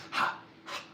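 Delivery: ordinary talk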